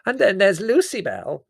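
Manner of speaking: put-on an effusive old aunt greeting; elderly aunt voice. A narrator's reading.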